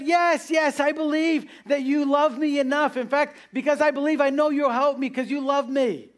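high-pitched, eager mock pleading; acted insistence, urgent and high; desperate agreement. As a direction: play a dangling man begging to be saved. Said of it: "The man said".

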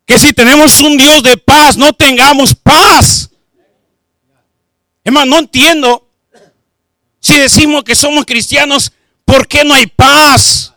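loud, fervent sermon delivery; preaching at full force. Acted. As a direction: preach it out to the congregation.

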